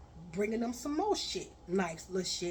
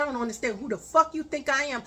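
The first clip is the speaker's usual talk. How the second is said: louder and faster, scolding; angry rant; 3 semitones higher, 5 dB louder, faster than usual. Retold or imitated. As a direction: tear into him, raising your voice. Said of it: "I said".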